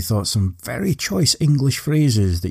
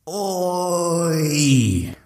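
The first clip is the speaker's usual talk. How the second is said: shouted Oi; 9 semitones higher than usual. Acted. Harry shouts to get attention.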